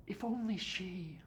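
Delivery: high-pitched mock pining; mimicked lament. Doctor quoting the family's talk of love, mimicked lament.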